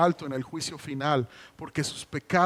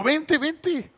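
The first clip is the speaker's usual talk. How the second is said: much higher, inviting call; eager call; 11 semitones higher, 5 dB louder than usual. Retold or imitated. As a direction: call the child over gladly. Father calls daughter over. (llamado alegre y agudo)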